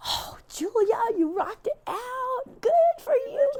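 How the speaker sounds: high, bubbly cheer for her effort; gushing praise. Acted. Volunteer cheering the camp mom, high gushing praise. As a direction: lay the praise on thick.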